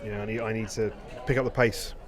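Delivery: ordinary talk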